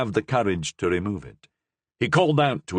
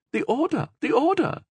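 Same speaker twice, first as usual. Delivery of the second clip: a light murmur in a higher voice. A narrator's reading. Superior murmuring an excuse to the Cardinal, lightly voiced. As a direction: mumble it as if excusing herself. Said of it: "who murmured".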